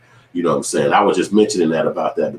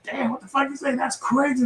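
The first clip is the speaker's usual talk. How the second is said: squeaky, much higher disbelief; high-pitched mock astonishment, quieter; shocked reaction; 6 dB quieter than usual. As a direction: react like the joke floored you. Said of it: "I'm like".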